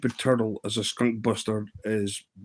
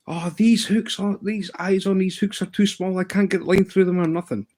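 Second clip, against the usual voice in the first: higher voice mocking a kid's complaint; imitating the boy; 8 semitones higher than usual. Imitating Kevin griping about small hook eyes, higher mock voice. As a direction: play the young angler griping about his kit.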